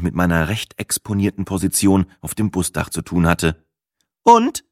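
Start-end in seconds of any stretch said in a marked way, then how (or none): 4.2-4.6 high, loud, eager question; interested. A narrator's reading. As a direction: call it out, keen to hear the answer. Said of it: "fragte sie interessiert". (interessiert nachgefragt)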